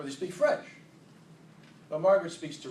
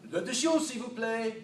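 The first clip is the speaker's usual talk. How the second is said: hammed-up French accent, higher; mock French accent; 8 semitones higher than usual. Shown as a demonstration. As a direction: play a tourist faking French. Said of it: "my entire French vocabulary".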